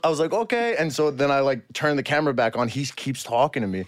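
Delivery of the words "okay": put-on German accent. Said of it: "keep this charade on".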